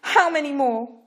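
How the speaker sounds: high, loud repeated demand. A narrator's reading. Parrot pressing Pharaoh, loud insistent demand. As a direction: press the question hard. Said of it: "Parrot demanded".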